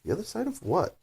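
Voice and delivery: high, puzzled wondering; puzzled thinking aloud. Acted. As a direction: puzzle over it under your breath. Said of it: "thought Alice to herself".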